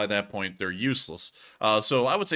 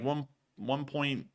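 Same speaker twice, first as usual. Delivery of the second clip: ordinary talk, nothing marked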